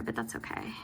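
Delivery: breathy whisper to herself; whispered aside. Real, not acted. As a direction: whisper it under your breath. Muttered self-reassurance aside.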